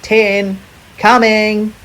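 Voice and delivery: high, loud wombat call. Acted. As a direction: call out to friends you can't see. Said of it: "called Little Wombat".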